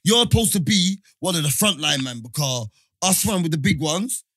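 normal talking voice

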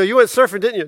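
high, loud mock wife voice; wife busting her sunburned husband; accusing wife. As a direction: catch him out, mock-suspicious. Wife's accusing quote.

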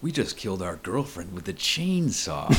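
high, drawn-out mocking mirror voice; mock reflection voice. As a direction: play the evil reflection taunting Ash. Voicing Ash's reflection quoting movie.

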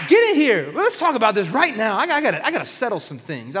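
put-on fed-up parent calling in fighting kids, loud then calmer; parent scolding. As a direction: yell them inside, then calm down to settle it. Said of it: "calling his children who are fighting".

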